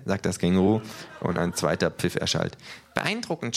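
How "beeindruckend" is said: high, softer flattering kangaroo; butter him up. A narrator's reading. Kangaroo flatters the thug's whistle, softly. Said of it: "schmeichelt das Känguru".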